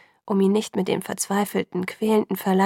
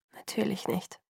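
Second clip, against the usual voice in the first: very soft murmur; 10 dB quieter than usual. A narrator's reading. Girl reacts to a dodged answer, murmuring. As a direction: mutter it under your breath. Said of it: "murmelte ich".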